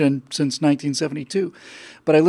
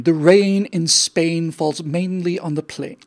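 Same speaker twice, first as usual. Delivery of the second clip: slow, lightly put-on posh British RP; mock RP; slower than usual. Henry Higgins drilling the Cockney woman, slow mock RP. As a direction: say it slowly like a proper English elocution drill. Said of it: "how to speak perfect received pronunciation".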